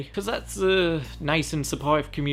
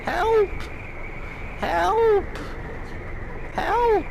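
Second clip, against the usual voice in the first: panicked cry for help; much slower than usual. Real, not acted. Player in trouble in the game, panicked high shouts. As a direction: yell "help" in short high bursts.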